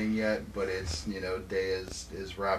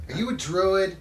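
put-on loud, high kid asking; excited child; 8 semitones higher, 7 dB louder than usual. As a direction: blurt it out like an eager kid. Little girl voice.

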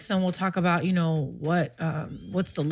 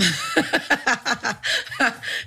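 laughing; 4 semitones higher than usual. Real, not acted. Laughing at her own twirl.

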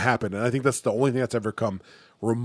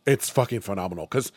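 usual speaking voice

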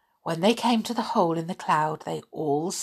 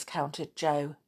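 ordinary delivery

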